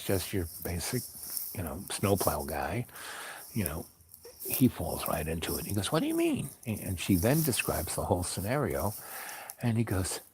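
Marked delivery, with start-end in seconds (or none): 5.9-6.5 very high-pitched question; mock astonishment, voice way up; imitated incredulous driver. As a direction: squeak out his disbelief. Driver drawn into the quarrel.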